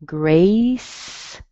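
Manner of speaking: "Grace," with a long A vowel, rising; teacher models "Grace,"; model pronunciation. Shown as a demonstration. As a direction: stretch the A in "Grace,". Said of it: "Be sure that you use an A".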